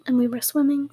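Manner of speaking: normal talking voice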